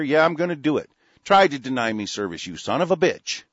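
loud, high, defiant; defiant rant. Real, not acted. Host vowing to confront clerks, loud defiant. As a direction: declare it like a fighter.

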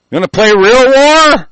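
high, loud challenging yell; furious high-pitched taunt; real shout. Real, not acted. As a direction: yell the dare at them. Ranting threat at authorities.

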